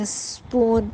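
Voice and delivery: Spoon drawn out slowly, sound by sound; slow phonics demo. Shown as a demonstration. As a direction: stretch spoon for a child. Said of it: "spoon میں دیکھیے کیسے sound ہے".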